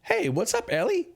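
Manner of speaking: squeaky voice pitched far too high; a failed try at the giant's voice; squeaky high greeting that misses the giant completely; wrong voice attempt. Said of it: "Wait, wait, no, that's not right".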